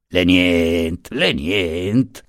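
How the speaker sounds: slow, unruffled teasing; mocking calm. A narrator's reading. Lawyer to the guard he just punched, mockingly phlegmatic. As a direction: dismiss the pain with lazy, mocking calm. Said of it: "con la solita flemma canzonatoria".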